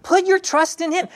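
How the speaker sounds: high, pleading voice urging himself; urgent self-pep talk. Acted. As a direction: coax your own sad soul, high-pitched. Psalmist urging his own soul.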